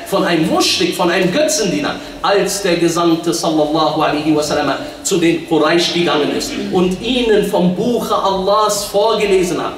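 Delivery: normal talking voice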